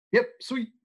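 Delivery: retold doorman cheerfully accepting; lightly voiced Sams. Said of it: "Sams go".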